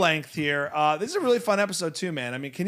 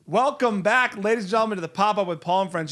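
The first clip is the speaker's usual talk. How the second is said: a lightly hammed-up host welcome, a bit louder; show host intro; 5 semitones higher, 5 dB louder than usual. Host greeting listeners at the top of the show, hammed-up welcome. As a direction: open the show like a stage host.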